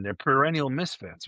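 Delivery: ordinary delivery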